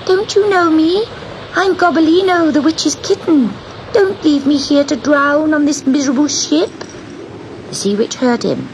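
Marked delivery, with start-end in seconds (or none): 0.0-6.7 high, pleading little kitten voice; kitten crying out. A narrator's reading. Kitten begging the witch not to leave him, high cry. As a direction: plead in a tiny high cat voice. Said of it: "he cried above the storm".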